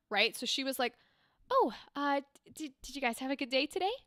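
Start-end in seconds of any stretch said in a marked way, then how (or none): 1.5-3.9 a gentle Lightkeeper voice, lifted a bit and softened; soft, slightly higher NPC. Shown as a demonstration. Streamer showing her first Lightkeeper NPC, soft and light. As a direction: lift it a little and soften, quietly fast. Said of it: "a little bit softer and a little bit higher".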